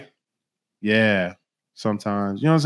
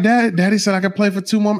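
put-on child voice; 8 semitones higher, faster than usual. Voicing the child again.

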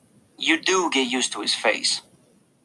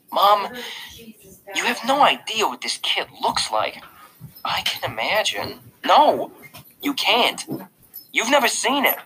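put-on higher-pitched, insistent boy protesting; insistent kid; 9 semitones higher than usual. A narrator's reading. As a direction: argue with Mom, pitching it up. Argument with mom in narrated story.

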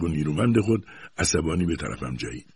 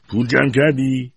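grandfather's sneering question, a bit higher; mocking; 8 semitones higher, 5 dB louder than usual. A narrator's reading. Grandfather sneering at the boy about money, mocking. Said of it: "با لحن استهزاآمیزی".

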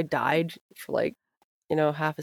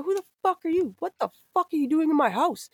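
even higher furious demand at the second guy; angry dad yelling; 11 semitones higher, faster than usual. Retold or imitated. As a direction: shout it up high, outraged father. Dad confronts second stranger.

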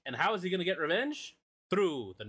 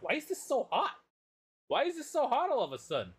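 mock alarmed; 10 semitones higher than usual. Character feels item burning.

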